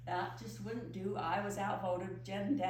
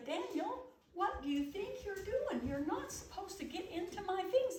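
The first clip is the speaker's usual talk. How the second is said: tearful scolding; 9 semitones higher than usual. Retold or imitated. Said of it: "I did start to cry".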